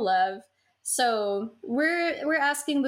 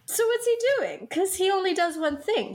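high mock suspicion; 9 semitones higher than usual. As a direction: pitch it up like a suspicious conspiracy theorist. Conspiratorial punchline.

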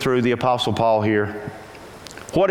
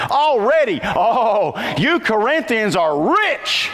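higher, slow, mocking praise of the Corinthians; sarcastic reading; 6 semitones higher, slower than usual. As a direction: drip sarcasm over every compliment. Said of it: "This is dripping with sarcasm".

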